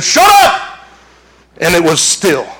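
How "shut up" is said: higher, louder command; commanding shout. Retold or imitated. Jesus commands the storm, loud shout. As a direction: order the storm to be still.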